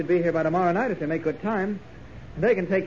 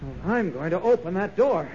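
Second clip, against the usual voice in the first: ordinary talk, nothing marked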